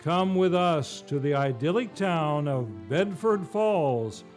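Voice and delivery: normal talking voice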